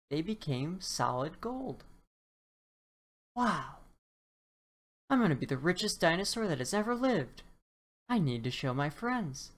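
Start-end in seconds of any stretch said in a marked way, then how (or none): 3.4-9.5 excited. Acted. Dinosaur thrilled by his golden touch, fast and excited. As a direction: gush about getting rich. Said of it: "said Topsy excitedly".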